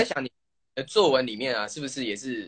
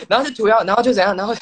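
high, louder teasing mimic of her storytelling; mocking imitation; 8 semitones higher, 8 dB louder, faster than usual. Friend mimicking the storyteller's habit of saying 然后, high and louder teasing. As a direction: parrot her endless 然后 to tease her. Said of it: "你一个句子有三个然后".

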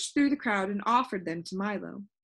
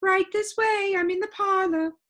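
high, lilting lady's voice; gentle singsong call; 10 semitones higher, 5 dB louder than usual. A narrator's reading. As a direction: sing it out gently from another room. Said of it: "a gentle voice sang out".

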